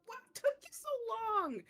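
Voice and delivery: high, loud, thrilled welcome; excited greeting. Acted. Jerush seeing his friend reach shore, excited greeting.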